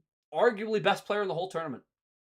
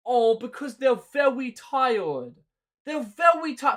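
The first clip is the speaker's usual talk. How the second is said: higher, slowed whiny mock excuse; sarcastic higher mimicry; 7 semitones higher, 4 dB louder than usual. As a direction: parrot the coach's excuse sarcastically. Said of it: "Sorry I keep doing this mocking voice".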